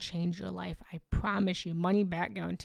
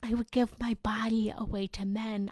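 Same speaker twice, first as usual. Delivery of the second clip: normal talking voice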